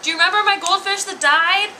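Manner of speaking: high, loud little-boy impression building to a cheer; excited kid. Kid tells mom his goldfish is human, mock thrilled. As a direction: imitate a child bursting with news. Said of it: "he's like".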